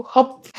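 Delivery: a drained, audible sigh of defeat; heavy sigh. Real, not acted. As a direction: let out one big weary breath. Rough month, then sighs.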